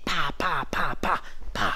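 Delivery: soft rapid pa pa pa; kick flurry sounds. Shown as a demonstration. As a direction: mimic a burst of kicks. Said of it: "imitating kick flurry".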